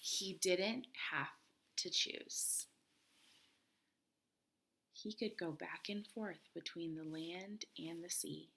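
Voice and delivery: soft whispered ending; hushed bedtime-story whisper. A narrator's reading. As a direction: whisper the happy secret.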